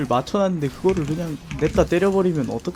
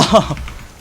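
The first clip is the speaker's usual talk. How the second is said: breathy disbelieving laugh; 5 semitones higher, 3 dB louder than usual. Real, not acted. Laugh after the yell.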